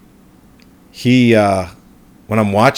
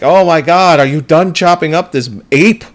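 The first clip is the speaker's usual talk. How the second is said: higher, louder mock outrage; exasperated complaint; 7 semitones higher, 5 dB louder than usual. City resident sick of the ape smell, exasperated. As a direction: complain as if you can't stand it any more.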